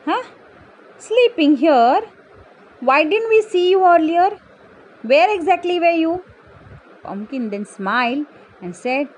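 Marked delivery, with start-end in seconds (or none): 0.1-6.1 high, loud disbelief; incredulous questioning. Acted. Animals question the pumpkin's claim, loud incredulous surprise. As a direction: question them in astonishment.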